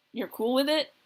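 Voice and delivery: usual speaking voice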